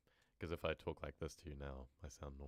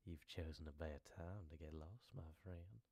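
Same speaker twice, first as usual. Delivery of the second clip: quietly menacing hillbilly; creepy voice; 2 semitones lower, 8 dB quieter than usual. Shown as a demonstration. The chosen take for a lost traveller, soft and creepy. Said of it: "I think that last one was good".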